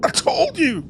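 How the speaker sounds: put-on a kid marvelling at the cat; amazed young Tom. Tom reacts to Martha's attack, high and amazed.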